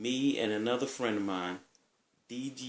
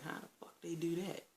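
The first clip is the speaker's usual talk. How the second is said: soft, high-pitched puzzled question; acted bafflement; 8 semitones higher, 10 dB quieter than usual. Recalling how he wondered about pizza by mail, baffled. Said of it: "scratching my head like".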